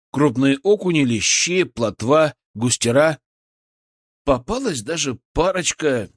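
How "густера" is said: ordinary delivery, nothing marked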